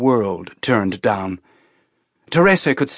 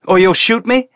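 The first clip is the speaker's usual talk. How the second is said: very high, loud taunting question; defiant challenge; 11 semitones higher, 6 dB louder than usual. A narrator's reading. Teresa daring the colonel to shoot, loud challenge. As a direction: dare her to do it.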